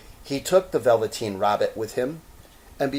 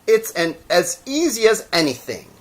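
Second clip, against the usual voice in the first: higher, louder, cocky; boastful wild rabbit; 8 semitones higher, 7 dB louder than usual. Acted. Wild rabbit bragging to the toy rabbit, cocky. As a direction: show off.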